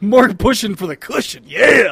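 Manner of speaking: loud, hammed-up whoop; mock-triumphant shout; mock cheer. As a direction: repeat it as a rowdy cheer, bursting on the last word. Gleefully repeating friend's garbled phrase.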